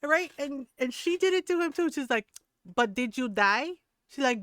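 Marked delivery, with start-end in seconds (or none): 2.7-3.7 louder, dismissive Filipino mother's comeback; mock-scolding Filipino mom. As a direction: imitate mom brushing off the complaint, loudly. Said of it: "She's just Filipino".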